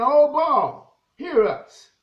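loud, mildly acted plea to a god; imitated cry of invocation; calling out. Voices the prophets calling on Baal.

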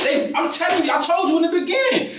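high and loud, voice straining upward; furious yelling. Real, not acted. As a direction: yell it in outrage at the accusation. Suspect yelling at detective.

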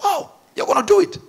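high-pitched astonished reaction; amazed. Acted. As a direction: gasp it out in high-pitched wonder. Angels amazed at the boast.

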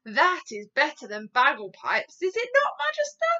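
loud, exultant giant, rising high; gleeful shout. A narrator's reading. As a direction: boast loudly to the Queen. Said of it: "shouted the B F G".

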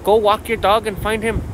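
usual speaking voice

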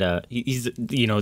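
usual speaking voice

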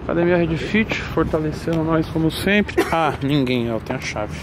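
loud, pumped-up vlogger shout; hyped gym vlog. Acted. Vlogger shouting to followers, loud and pumped. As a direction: hype the camera after the pre-workout.

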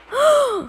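high shocked gasp; a sharp, high play gasp; pretend gasp. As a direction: gasp as if wrongly accused. Accused toy reacts shocked.

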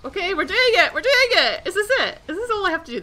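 loud, high-pitched giddy cheering; excited. Real, not acted. Gamer finally winning the dragon fight, excited cheering. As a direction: cheer the team on in rising excitement. Fight suddenly going well.

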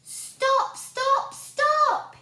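very high, loud desperate yell. A narrator's reading. Boy yelling at the pot in the night. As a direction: yell stop over and over. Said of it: "he yelled".

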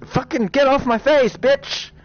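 raging scream at the game; angry yell. Real, not acted. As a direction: blow up at the thing stuck on your face. Frustrated at the hand in view.

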